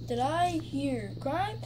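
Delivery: ordinary talk, nothing marked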